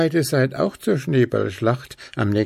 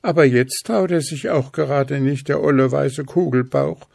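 higher, mildly cross voice; cross Schnuffi; 6 semitones higher, 3 dB louder than usual. A narrator's reading. Schnuffi is cross with the snowman, mildly annoyed. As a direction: grumble at the shy snowman. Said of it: "war Schnuffi erbost".

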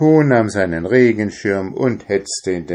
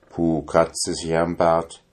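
ordinary delivery

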